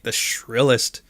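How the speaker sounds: ordinary delivery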